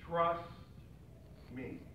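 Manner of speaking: soft, low, hushed trust me; whispered. Acted. Preacher voicing God's whisper to the congregation, hushed. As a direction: breathe it as God's gentle whisper. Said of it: "where God simply whispers".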